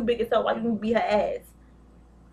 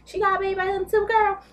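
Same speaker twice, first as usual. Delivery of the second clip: high, sassy gossip delivery, much higher; mock gossip squeal, pitched way up; gossipy girlfriend voice; 10 semitones higher, 4 dB louder than usual. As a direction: spill the tea like a messy girlfriend.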